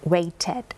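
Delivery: waited with the ed said as a clear extra syllable "id". Shown as a demonstration. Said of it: "พอใส่ ed เข้าไปก็จะเป็นเพิ่มอีกหนึ่งพยางค์". (ออกเสียง waited เป็นสองพยางค์ชัดๆ)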